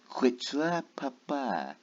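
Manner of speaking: normal talking voice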